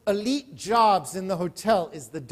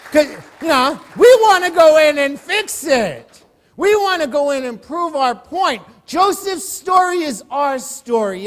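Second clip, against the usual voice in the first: preaching at full force; 4 semitones higher, 4 dB louder than usual. Acted. Preacher rousing the congregation toward faith, full-force preaching. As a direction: drive the lesson home to the congregation.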